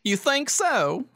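high-pitched Southern belle; coy high Southern question; Southern accent. Acted. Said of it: "I wanna do Southern".

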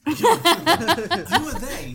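slow, high, drawn-out question; mock dramatic. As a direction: stretch it out theatrically.

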